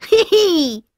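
high playful giggle; a little kid laughing; giggling. Acted. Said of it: "laughs Twig".

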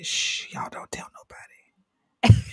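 hushed whisper, sharing a secret; Ruby's secret whisper. Pretend. Ruby hushing her audience in a whisper. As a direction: whisper it like gossip nobody should hear. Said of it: "That's how y'all know it's coming".

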